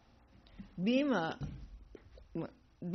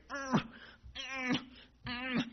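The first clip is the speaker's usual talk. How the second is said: mock effortful ugh grunts, pitched up; straining grunts; 7 semitones higher than usual. Bhima failing to lift the tail, grunting. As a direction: strain as if lifting something heavy.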